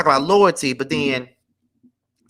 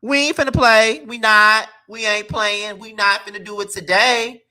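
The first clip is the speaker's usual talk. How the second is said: normal talking voice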